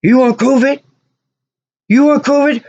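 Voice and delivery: high, teasing taunt, pushing in close; mock the customer with it; taunting mechanics. Mechanics taunting, pushing towards him.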